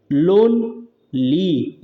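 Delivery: "Lonely" very slow, split as लोन - ली; syllable demo. Shown as a demonstration. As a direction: stretch "लोन", pause, then say "ली". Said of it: "एक ये syllable".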